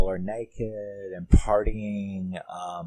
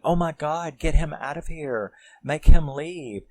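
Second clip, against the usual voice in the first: higher, urgent voice of a mother; panicked mom; 7 semitones higher, 4 dB louder than usual. Retold or imitated. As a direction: imitate a mom demanding he leave, building louder. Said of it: "you hear the mom like going".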